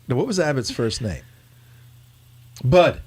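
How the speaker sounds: normal talking voice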